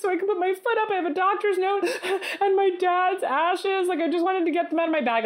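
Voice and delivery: high, sniffly fake-crying plea; mock tearful self. Tearful plea to a kind stewardess. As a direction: hams up the weepy victim to a stewardess.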